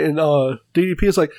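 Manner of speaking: ordinary talk, nothing marked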